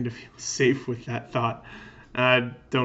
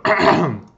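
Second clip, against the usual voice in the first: loud exaggerated throat clear; 5 semitones higher, 10 dB louder than usual. Neo clearing his throat before speaking, loud ahem. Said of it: "He clears his throat".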